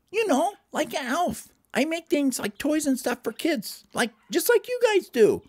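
chirpy high elf voice; high cartoon elf; Gerald. Elf explains himself.

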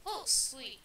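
usual speaking voice

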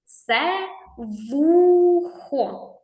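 slow and clear. Shown as a demonstration. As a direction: spell out Це вухо very slowly for kids. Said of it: "Що це, діти?". (дуже повільно і чітко «Це вухо»)

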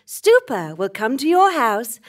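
ordinary talk, nothing marked

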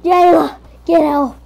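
loud pleading call to Jayla; playful cry for help to a friend. Pretend. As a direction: shout for Jayla, mock desperate. Calling friend for help while dying.

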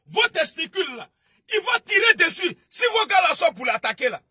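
loud, high threatening yell; angry shout. Real, not acted. Threatening rant on stream. (menace criée, forte et aiguë)

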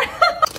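very high, breathy laughing squeal; squeaky laugh. Real, not acted. Sour candy reaction.

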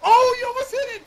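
very high, loud near-miss cry; excited yelp. Real, not acted. Teammate watching a friend's shot land close, excited yelp. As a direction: squeal it as the shot just misses.

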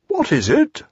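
high startled squawk of an owl. A narrator's reading. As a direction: jump at the question. Owl voiced in story.

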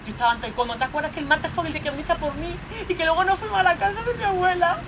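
tearful protest. Acted. Pamela begging Antonio not to shout at her, crying. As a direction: argue back through tears, climbing to a cry. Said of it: "Lloro porque me estás diciendo una cosa".

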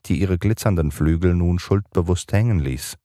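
ordinary delivery, nothing marked

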